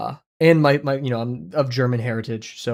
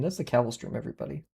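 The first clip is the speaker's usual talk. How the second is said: softer echo; 8 dB quieter, faster than usual. Real, not acted. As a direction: repeat "that's the Cavill stream" much softer, falling. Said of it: "but hey, if that's the Cavill stream".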